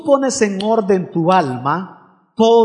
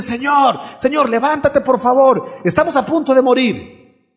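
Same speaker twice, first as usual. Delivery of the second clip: panicked plea; 6 semitones higher than usual. Acted. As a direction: beg the master to wake up, terrified. Disciples panic in storm.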